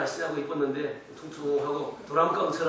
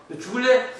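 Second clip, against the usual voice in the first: threatening father; 6 semitones higher, 7 dB louder than usual. Retold or imitated. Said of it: "막 그러잖아요".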